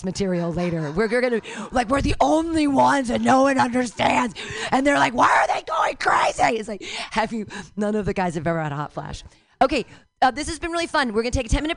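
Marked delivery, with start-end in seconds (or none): 5.1-6.6 mocking shout. Mocking men about menopause.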